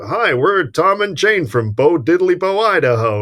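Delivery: slow, sing-song Midwestern American caricature; hammed-up elderly American tourist drawl; typical American tourist. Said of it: "this typical American couple who".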